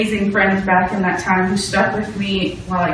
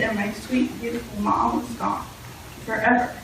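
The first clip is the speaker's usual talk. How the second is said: hushed grief; 9 dB quieter than usual. Real, not acted. Mourning dead mother.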